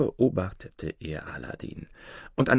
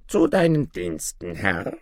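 servile dwarf. A narrator's reading. Dwarf greeting his master, lightly voiced and servile.

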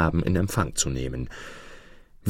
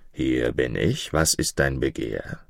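mountain spirit; 6 semitones lower than usual. A narrator's reading. Spirit presenting himself to the mother who called, low and solemn. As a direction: answer the summons low and measured.

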